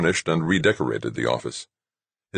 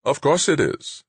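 ready polite agreement; 11 semitones higher than usual. A narrator's reading. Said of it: "agreed readily".

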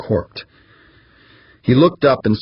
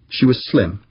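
ordinary delivery

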